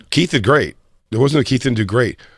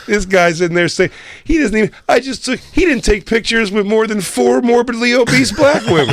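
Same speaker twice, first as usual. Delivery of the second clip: much higher, incredulous retold rant; indignant rant; 10 semitones higher than usual. Comic retelling griping about Keith to a friend, high incredulous voice. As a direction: squeal the outrage at him. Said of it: "I was like".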